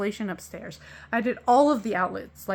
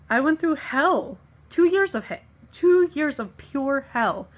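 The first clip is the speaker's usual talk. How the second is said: higher, louder, slowed and insistent; heated emphasis; 5 semitones higher, 5 dB louder than usual. Real, not acted. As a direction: hammer home how bad it was. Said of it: "I'm very passionate about this".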